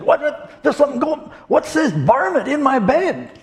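high, alarmed mock outcry; a rancher startled by a varmint; Texan on the phone, startled; shocked Texan.

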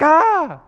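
high, loud "ah"; mock yelp of being caught; startled cry. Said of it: "you feel like you got caught doing something wrong".

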